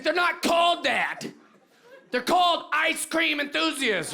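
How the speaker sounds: high-pitched mock scolding; indignant correction. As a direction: play an outraged youngster setting an elder straight. Young woman corrects old man, acted.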